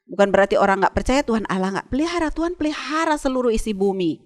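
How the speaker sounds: usual speaking voice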